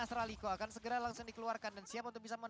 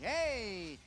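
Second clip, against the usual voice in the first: sudden shout; 2 semitones lower, 5 dB louder than usual. Real, not acted. Caster reacts to sudden play.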